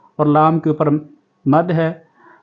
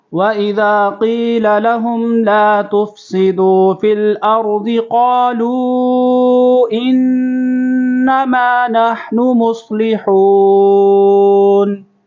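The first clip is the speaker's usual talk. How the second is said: slow, higher melodic tajweed chant; Quran recitation; 6 semitones higher, 3 dB louder, much slower than usual. Shown as a demonstration. Teacher recites the verse for students to repeat, in melodic chant. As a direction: recite it melodiously, stretching the long vowels.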